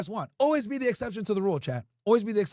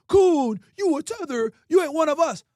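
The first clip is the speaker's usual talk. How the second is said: loud, high sneering taunt; mocking critics; 6 semitones higher, 5 dB louder than usual. Streamer mocking the critics who call him names, sneering and loud. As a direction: throw the insults back like the haters say them. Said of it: "they call me".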